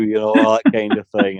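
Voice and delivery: ordinary talk, nothing marked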